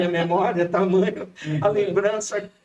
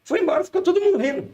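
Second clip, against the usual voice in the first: laughing; 12 semitones higher, faster than usual. Real, not acted. Amused, very high narration.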